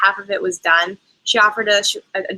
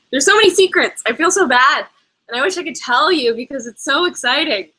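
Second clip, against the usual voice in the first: excited; 6 semitones higher than usual. Real, not acted. Gushing about secrets she can't share.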